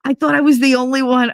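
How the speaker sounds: high, eager retelling of a thrilled man; excited friend. Retold or imitated. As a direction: gush like you finally found a fellow fan. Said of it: "He was like".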